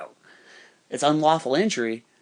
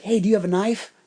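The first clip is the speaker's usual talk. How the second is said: higher, lightly imitated guy's voice; retold eager question; Wendell's sudden question; 7 semitones higher, 4 dB louder than usual. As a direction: ask it urgently, pitched up.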